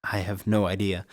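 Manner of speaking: normal talking voice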